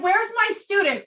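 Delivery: high eager calling for his student. Retold or imitated. Urologist looking for his student, eager high call. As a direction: call out for her, keen and bright. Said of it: "He's like".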